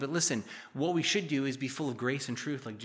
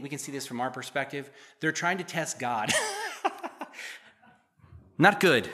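ordinary talk

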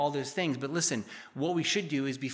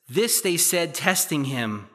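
louder, measured Bible reading voice; scripture reading; 6 dB louder, slower than usual. Acted. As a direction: read the passage out to the congregation. Reads the scripture passage aloud.

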